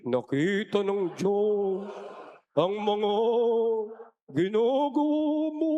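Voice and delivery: teasing a churchgoer with a creepy warning, slow and ominous; scary voice. Shown as a demonstration. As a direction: drag it out to scare your neighbour. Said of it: "Gawin mo nakakatakot yung boses mo".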